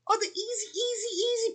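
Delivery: high squeaky singsong; high singsong call. Pretend. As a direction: sing the name out high. Calling out cartoon names.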